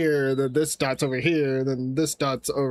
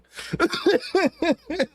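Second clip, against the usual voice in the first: high-pitched burst of real laughter; 12 semitones higher than usual. Real, not acted. Laughing at chat's explanation of the layering, a high burst of laughter. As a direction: crack up helplessly, high.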